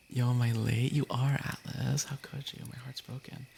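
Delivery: ordinary delivery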